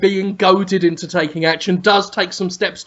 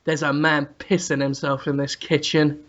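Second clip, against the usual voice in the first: low, soft understated remark; hushed, lowered voice; really quiet; 7 semitones lower, 6 dB quieter than usual. Acted. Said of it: "just says really quietly".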